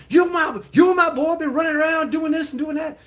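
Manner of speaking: imitating a mom yelling at her son. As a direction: tear into the kid like an old-school mom. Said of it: "yelled at by".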